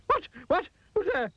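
very high, jumpy yelps; startled. A narrator's reading. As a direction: jump out of your skin. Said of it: "nearly jumped out of his skin".

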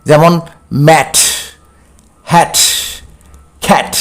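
ম্যাট, হ্যাট with a crisp retroflex ট at the end; the final ট of খ্যাট said clearly. Shown as a demonstration. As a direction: model each word, spaced out. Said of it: "খুব clearly করতে হবে".

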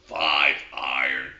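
a low, slow little frog calling out the club; talking frog. Acted. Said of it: "and the frog goes".

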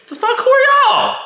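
very high, loud squawk; indignant outburst. Acted. Woman dismissing the nosy friends calling her, indignant. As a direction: squawk it in outraged disbelief.

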